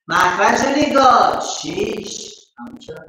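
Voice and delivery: normal talking voice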